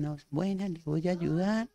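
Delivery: very soft, slow, half-hearted helper; mock a weak offer, quiet and dragging; feeble, unconvincing offer. Shown as a demonstration. First-aider to a patient, as not to do it, feebly. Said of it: "me habla bien, no con".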